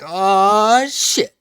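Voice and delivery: drawn-out rising "aw", then high; mock realization. Finn seeing the door left open, mock dawning realization. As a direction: let the realization climb slowly, hammed-up.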